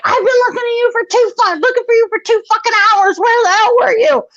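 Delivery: fast, high-pitched imitated scolding; screaming mom. Mom who searched two hours for her child, fast mock screaming. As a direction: act out a frantic mother's rapid screaming. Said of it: "she's screaming at me".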